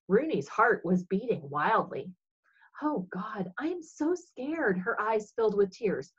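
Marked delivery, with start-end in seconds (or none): 2.8-4.8 hushed, scared breathy prayer; frightened whisper. Acted. Frightened girl praying as the boys fight, whispered. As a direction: whisper your fear to God.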